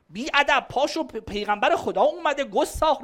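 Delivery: mock scolding. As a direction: play the angry parent telling the kid off.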